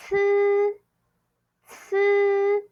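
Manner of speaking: "ts" with a buzzed vowel, long, high and level; first tone. Shown as a demonstration. Teacher reviewing C and C I, long high level "ts". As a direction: hold "ts" on a steady high note. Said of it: "Bring it to first tone".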